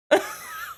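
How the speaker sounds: high airy laugh; breathy giggle. Real, not acted. Streamer laughing at her own joke.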